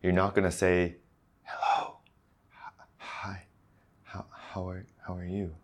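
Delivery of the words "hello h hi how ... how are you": very soft, hesitant whisper; whispering to yourself. Shown as a demonstration. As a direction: mumble it under your breath, haltingly. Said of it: "If you're whispering the sounds to yourself".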